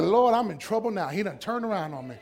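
worried she is caught; afraid. Acted. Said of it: "She was afraid".

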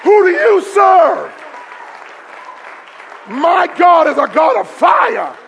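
shouted pulpit declaration; full-force preaching. Real, not acted. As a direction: fire up the congregation phrase by phrase.

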